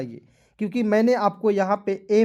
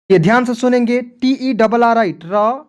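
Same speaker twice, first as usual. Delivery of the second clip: loud, raised teacher voice; emphatic attention call; 4 semitones higher, 9 dB louder than usual. Real, not acted. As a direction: call the class to attention, higher and much louder. Calling for attention before key explanation.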